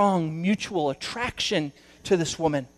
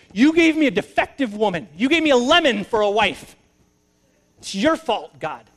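high, loud blaming voice; accusing Adam; 4 semitones higher, 5 dB louder than usual. Acted. Adam to God, blaming him, loud and high. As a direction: throw the blame back at God. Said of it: "Adam is blaming God".

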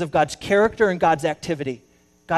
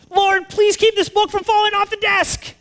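much higher, louder mock plea; screamed prayer; 7 dB louder than usual. Pastor to God over the falling book, screaming. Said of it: "the pastor screamed out".

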